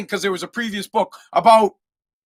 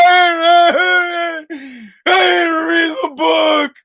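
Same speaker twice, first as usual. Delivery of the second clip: high shouted mock wail, very slow; imitating son crying; 10 semitones higher, 4 dB louder, much slower than usual. Dad mocking his son's crying confession. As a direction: wail each word through fake tears. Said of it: "He starts crying".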